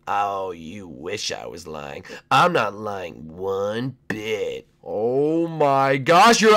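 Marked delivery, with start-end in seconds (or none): 0.1-4.7 slow low taunt. Acted. Leak skin character taunting.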